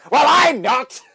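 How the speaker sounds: high, loud, indignant shout; Skeletor shouting. Acted. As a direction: yell it like an outraged villain.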